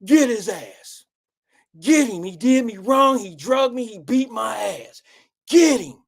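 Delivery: hammed-up egging on; girlfriend's silent look. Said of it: "with this look on her face, like".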